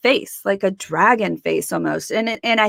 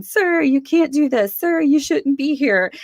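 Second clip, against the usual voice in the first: higher, raised voice ordering the intruder out; firm protest; 7 semitones higher than usual. Retold or imitated. Telling the military figure to leave, raised. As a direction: stand your ground with the intruder. Confronting the apparition.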